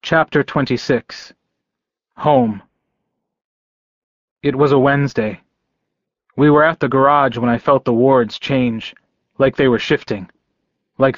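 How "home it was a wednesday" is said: ordinary delivery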